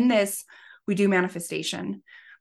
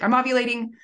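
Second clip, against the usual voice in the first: retold excited announcement, higher and louder; 5 semitones higher, 5 dB louder, faster than usual. Wife calling her husband with news, retold as excited. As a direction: re-enact breaking big news, pitched up. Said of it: "I was so excited".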